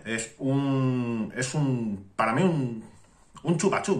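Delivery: talking around vape mouthpiece; speaking with device at lips. Real, not acted. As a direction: lips pressed on atomizer. Seen 0: Holds vape top against lips throughout the speech, sniffing it.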